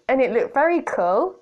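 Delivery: ordinary delivery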